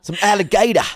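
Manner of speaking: high, hammed-up Aussie voice; amused mock-Australian twang; Australian accent. Offering Aussie food to the co-host, Australian accent. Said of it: "you've got very good Australian accent".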